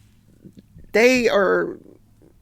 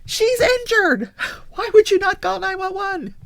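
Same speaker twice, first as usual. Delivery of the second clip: exasperated plea; 13 semitones higher, 3 dB louder than usual. Retold or imitated.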